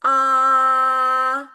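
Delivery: Ah high and level, held; Ah as a high level Mandarin tone; tone one. Shown as a demonstration. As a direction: sing Ah flat and high for the class. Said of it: "nada satu adalah nada yang datar dan tinggi".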